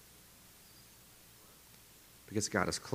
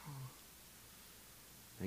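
tiny whispered surprise; 4 semitones higher, 24 dB quieter than usual. Retold or imitated. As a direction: breathe a soft oh. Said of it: "I was like".

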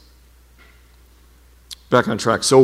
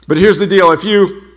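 normal talking voice

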